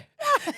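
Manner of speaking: high squealing laugh. Real, not acted. Laughing at her rash.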